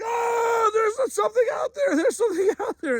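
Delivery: high, frantic shout of alarm; imitating a terrified friend yelling; panicked. Friend panics at animal noise.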